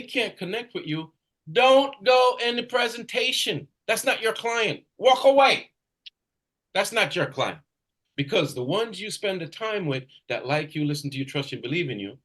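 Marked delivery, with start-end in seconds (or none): 5.0-7.6 emphatic command. Real, not acted. Coach urging agent to drop client.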